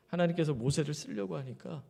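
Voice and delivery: ordinary talk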